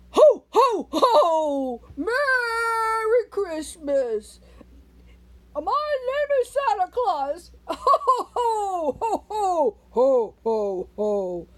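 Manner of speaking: loud, booming jolly Santa; hearty pretend Santa greeting the kids; pretend Santa Claus. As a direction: play Santa with big ho ho hos. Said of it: "I like to pretend like I'm Santa Claus".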